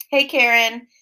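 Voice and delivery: usual speaking voice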